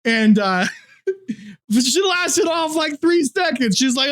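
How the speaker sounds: ordinary delivery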